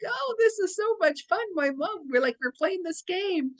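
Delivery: high-pitched, delighted pet voice; excited, squeaky-high imagined dog talk; playful dog's voice. Acted. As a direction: voice an overjoyed big dog at play. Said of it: "He'd be like".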